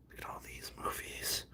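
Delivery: soft unvoiced whisper. Real, not acted. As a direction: whisper it to yourself. Whispered to himself.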